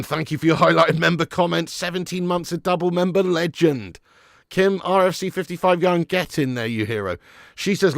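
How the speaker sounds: usual speaking voice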